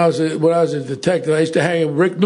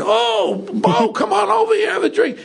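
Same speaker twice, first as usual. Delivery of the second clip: high-pitched, jovial call over; suddenly all friendly, voice leaping up high; effusive welcome; 11 semitones higher than usual. Retold or imitated. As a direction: hail an old pal in a high voice. Retold sudden friendly invitation to drink.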